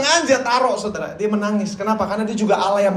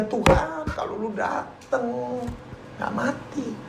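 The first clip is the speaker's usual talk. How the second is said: much softer, slow hurt words to the Lord; quiet grieving plea; 3 semitones higher, 9 dB quieter, slower than usual. Retold or imitated. Martha reproaching Jesus at her brother's death, soft and hurt. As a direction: let it drop to a wounded hush.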